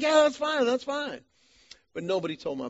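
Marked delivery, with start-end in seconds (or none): 0.0-1.2 much higher, louder forced cheer; fake cheerful. Husband hiding dread from wife, high forced cheer. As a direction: sell it like it's no problem. Faking okay to his wife.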